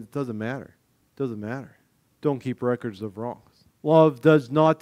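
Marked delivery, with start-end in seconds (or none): 0.0-3.6 gentle Jesus. Retold or imitated.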